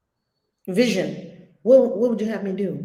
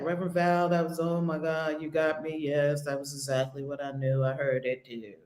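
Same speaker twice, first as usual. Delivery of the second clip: awed recipient; 8 semitones lower, 7 dB quieter than usual. Retold or imitated. Recipient voiced in story.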